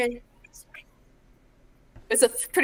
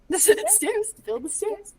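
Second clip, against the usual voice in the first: imitating; 9 semitones higher than usual. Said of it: "He says it like".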